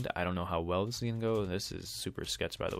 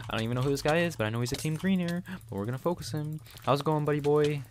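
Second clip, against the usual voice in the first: lightly higher, teasing silly voice; goofy put-on voice; 7 semitones higher, 4 dB louder than usual. Player mocking a rival in a goofy voice. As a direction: keep up the mock voice, a bit louder. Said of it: "we're done talking like this".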